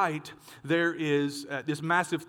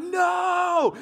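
long, high, loud storyteller's shout; hammed-up drawn-out cry of dread; mock panicked yell; 13 semitones higher, 5 dB louder than usual. Preacher acting out fleeing a leaping dog, mock panicked yell. Retelling his flight from dog.

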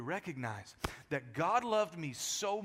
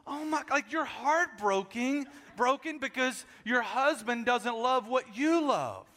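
ordinary talk, nothing marked

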